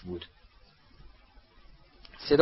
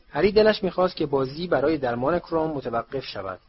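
plain reading voice

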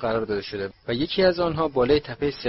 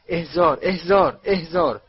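raised, louder spell-casting cry; repeated shouted command; 5 semitones higher, 6 dB louder, slower than usual. A narrator's reading. Mother summoning hidden toffees from pockets, shouting each command. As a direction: call out each command forcefully, pitched up.

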